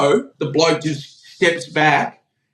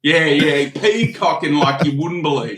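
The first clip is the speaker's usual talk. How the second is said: ordinary talk, nothing marked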